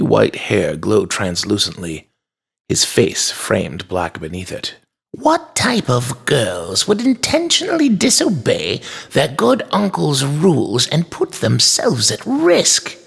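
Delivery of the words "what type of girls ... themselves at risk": high, changed voice, slowing as it goes; stern toy maker. A narrator's reading. Uncle scolds disobedient girls, stern. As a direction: spell out the reproach.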